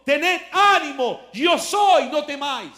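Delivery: commanding call. Acted. Jesus calling to disciples.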